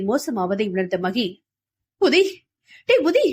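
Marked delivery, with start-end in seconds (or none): 2.0-3.3 high, loud calling out his name; frantic shout to a frozen man; urgent call. A narrator's reading. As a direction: shake him awake with your voice. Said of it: "என உதயனை ஒழுக்கினாள்".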